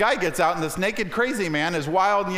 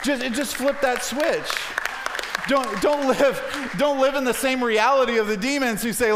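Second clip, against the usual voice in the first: raised, insistent pulpit appeal; urgent exhortation; 6 semitones higher than usual. Real, not acted. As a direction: urge the congregation, stretching each "don't". Preacher exhorts congregation at climax.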